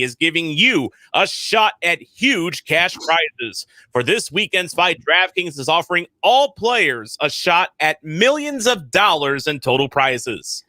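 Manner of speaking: upbeat sponsor read; smooth podcast ad voice. Acted. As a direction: sell it like a radio spot. Host reads sponsor ad.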